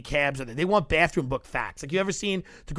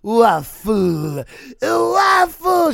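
loud, rising excited yell of waffle; 8 semitones higher, 10 dB louder, much slower than usual. Retold or imitated. Belgian lady on the tour spotting a waffle truck, excited yell. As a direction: shout it like a thrilled Belgian lady. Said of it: "started yelling waffle".

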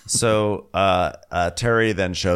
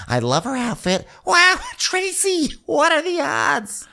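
gushing Shannon impression. Mocking Shannon gushing over a shared healer. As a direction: squeal it out like a starstruck fan.

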